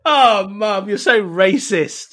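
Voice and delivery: loud, high, drawn-out protest; mock outrage. Child protests at mum's Yorkshire verdict, mock outraged. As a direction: scold mum, half laughing.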